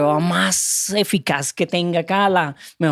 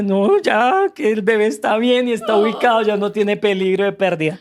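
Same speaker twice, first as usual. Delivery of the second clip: retold tearful relief; 4 semitones higher than usual. Husband imitating his wife leaving the doctor in tears, mild tearful relief. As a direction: echo the wife's shaky relief, lightly. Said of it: "ella sale llorando".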